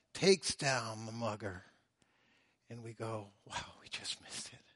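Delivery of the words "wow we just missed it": relieved whisper. Pretend. Relieved victim after mugging.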